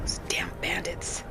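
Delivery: slow, hushed, bitter whisper; cursing the bandits under his breath; whispered curse. Acted. Muttered curse at bandit bodies.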